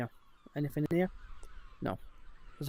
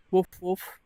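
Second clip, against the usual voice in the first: playful woof; 3 semitones higher than usual. Pretend. Talking to a village dog, playful imitated barks. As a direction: bark back at the dog playfully.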